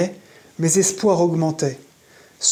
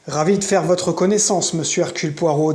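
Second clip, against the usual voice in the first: ordinary delivery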